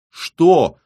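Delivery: loud, high, curt "what"; brusque, irritated retort; rude. A narrator's reading. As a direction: snap back, annoyed at being interrupted. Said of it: "она ответила грубо".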